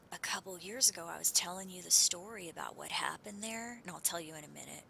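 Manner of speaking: ordinary delivery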